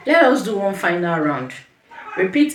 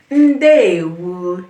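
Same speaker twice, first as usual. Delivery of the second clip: "Ndewo" drawn out slowly and louder; slow model pronunciation; 7 dB louder than usual. Shown as a demonstration. Teacher repeats the greeting for beginners, "Ndewo" slow and loud. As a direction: stretch both syllables of "Ndewo" for learners. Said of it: "One more time".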